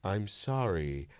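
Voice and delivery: low, slow and soft deadpan; lightly voiced intense Malkovich; intense John Malkovich. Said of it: "the most intense, he went".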